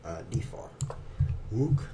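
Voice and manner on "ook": mock French ook. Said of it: "I love the way he says that".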